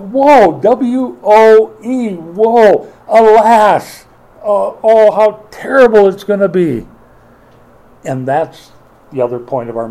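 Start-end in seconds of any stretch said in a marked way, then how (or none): 0.0-6.9 loud, higher proclamation of woe; woeful lament. Real, not acted. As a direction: cry out the doom like a prophet. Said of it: "has the phrase, "Woe".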